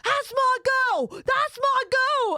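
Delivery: high, drawn-out Cockney shout; imitated Cockney cheer, loud and slow; old school Cockney. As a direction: bellow it like a proud Cockney husband. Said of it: "old school Cockney".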